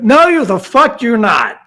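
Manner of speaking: loud, high, slowed-down outburst; retold angry refusal. Caller refuses to be put back in the loop, mock outrage. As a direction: push back hard, stretching the indignant words.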